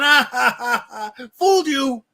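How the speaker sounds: taunting cackle, jumping high and loud on "fooled you"; mocking laugh. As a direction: gloat like a villain who got away with it. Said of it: "laughing, going".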